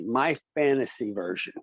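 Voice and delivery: usual speaking voice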